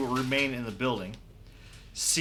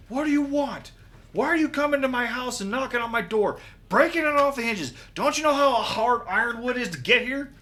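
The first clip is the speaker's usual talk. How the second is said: high, loud, fast-tumbling tirade; angry knight; 11 semitones higher, 5 dB louder, faster than usual. Acted. Knight scolds party for breaking his door, angry rant. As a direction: rant at full volume, pitched up.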